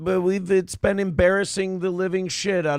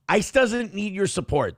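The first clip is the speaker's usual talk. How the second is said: ordinary talk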